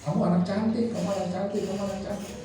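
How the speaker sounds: sing-song praise to a daughter; doting praise. Retold or imitated. Father praising daughter Sania.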